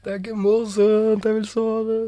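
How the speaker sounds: put-on high, slow whining child voice; whiny kid. Sleepy kid complaining to dad, high whining. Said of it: "Og ungene bare".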